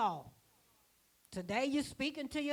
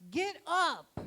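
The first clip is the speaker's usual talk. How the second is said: a raised, commanding GET UP; commanding call; 6 semitones higher, slower than usual. Retold or imitated. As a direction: call them to stand up. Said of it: "So he said".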